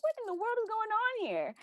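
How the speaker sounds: very high, bewildered exclaim; retold disbelief. Signing up twice for the patron, baffled high exclaim. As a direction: lift it way up in baffled wonder.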